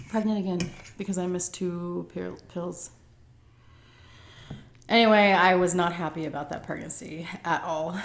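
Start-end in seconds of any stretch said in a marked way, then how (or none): none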